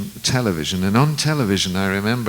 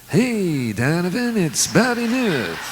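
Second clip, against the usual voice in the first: much higher, slow [name] drawl imitation; 10 semitones higher, slower than usual. Tour manager phoning [name], imitated in a high, slow [name]-style voice. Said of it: "everybody in [name]'s entourage sounded like him".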